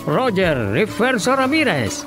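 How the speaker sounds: normal talking voice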